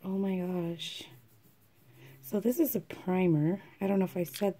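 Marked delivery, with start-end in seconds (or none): none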